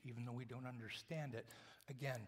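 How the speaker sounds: ordinary talk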